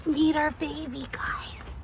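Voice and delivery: hushed whisper to the camera. Real, not acted. Revealing baby, hushed.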